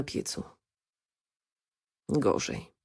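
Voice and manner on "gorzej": breathy glum whisper. Acted. Wiktor glumly admits things are worse, in a whisper. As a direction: whisper it, disheartened. Glum answer about his expectations.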